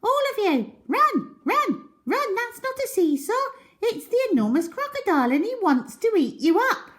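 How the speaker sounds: put-on monkey warning children about the crocodile; urgent shout. A narrator's reading. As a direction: scream it so they run. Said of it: "Mugglewump shouted to the children".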